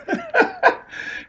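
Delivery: squealing high laugh; high giggle. Real, not acted. Telling the story, he bursts into a high giggle.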